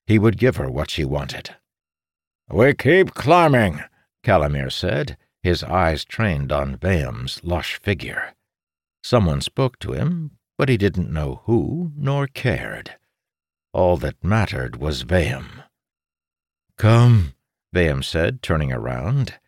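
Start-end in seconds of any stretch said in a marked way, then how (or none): 2.5-3.8 loud, high, drawn-out order; commanding. A narrator's reading. Calamir ordering tired soldiers onward, loud command. 16.8-17.3 loud, higher beckoning command; calling out. A narrator's reading. Vayam turning to lead the party, loud summons. Leader calls the party onward.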